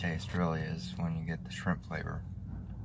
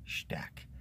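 whispered correction; 10 dB quieter than usual. Real, not acted. Self-corrects misread word.

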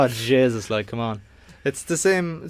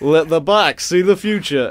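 slow, higher northern English impression; hammed-up northern English accent; 4 semitones higher, 6 dB louder than usual. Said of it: "sound like [name]".

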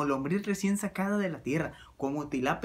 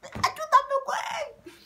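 high, loud strangled plea; panicked choking cry; 4 dB louder than usual. Acted. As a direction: beg for help while choking, way up high. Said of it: "Así como de".

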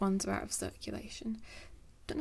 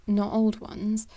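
ordinary delivery

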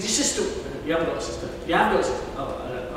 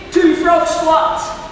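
slow, loud, higher call; 8 semitones higher, 7 dB louder, slower than usual. Real, not acted. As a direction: coach calling the frog squats. Calling out race commands.

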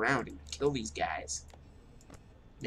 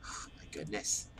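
weary whisper; 7 semitones lower, 7 dB quieter, slower than usual. Real, not acted. Sigh, slow whisper.

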